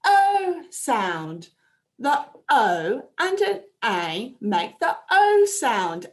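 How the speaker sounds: O drawn out and stressed each time. Shown as a demonstration. Teacher showing the class the O sound. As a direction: lean on O so children catch it. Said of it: "This is the sound that we're learning".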